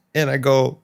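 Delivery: normal talking voice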